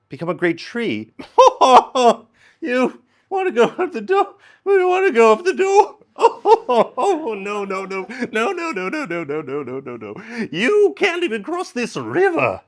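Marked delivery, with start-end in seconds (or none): 1.2-2.2 loud high mocking laugh. The spirit laughs at the human's tree door plan, loud high cackle. 2.6-12.6 high, laughing spirit mocking; laughing mockery. The spirit mocks the human's plan while laughing, high.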